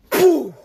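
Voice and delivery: sudden loud, high "boo" blast; loud boom. Retold or imitated. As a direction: mimic an explosive bang with your voice. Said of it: "like, a real loud sound".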